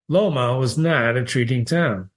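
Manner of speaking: plain reading voice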